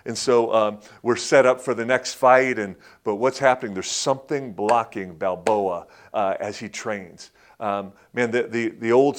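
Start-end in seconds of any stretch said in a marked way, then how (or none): none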